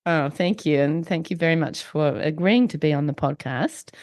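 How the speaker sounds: croaky voice with a chest cold; blocked nose, wheezy and croaky. Real, not acted. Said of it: "wheezy voice in this episode".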